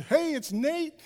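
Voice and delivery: high, loud cheery call; retelling his call to the dog. Retold or imitated. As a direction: greet the dog brightly over the phone. Talking to the dog by phone.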